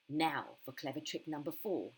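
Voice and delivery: ordinary delivery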